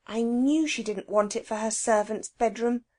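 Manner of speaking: plain reading voice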